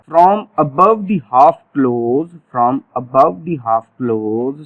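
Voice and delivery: very slow, each word spaced out; stretched-out classroom dictation; slow drill phrase. Shown as a demonstration. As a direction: dictate it slowly for the class to repeat. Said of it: "देखिएगा जरा".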